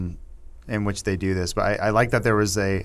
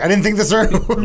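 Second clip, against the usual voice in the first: sheepish Magneto; 9 semitones higher than usual. Retold or imitated. Magneto voiced in retelling.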